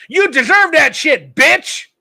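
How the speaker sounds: loud taunting jab; evil opinion. Real, not acted. Host gloating at the man who lost his house, loud. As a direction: sneer it out, gloating. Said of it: "honest with my stupid ass evil opinion".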